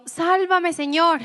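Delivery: high, loud plea for rescue; desperate cry. Acted. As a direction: cry out like a man who is sinking. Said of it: "Y gritó".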